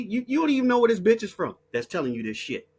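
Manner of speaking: ordinary delivery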